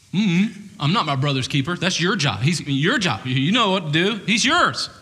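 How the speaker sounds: rising, defiant, prideful retort; obstinate. Retold or imitated. Cain sulkily dodging God's question, prideful and obstinate. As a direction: toss it back with a sulky shrug. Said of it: "And Cain's like".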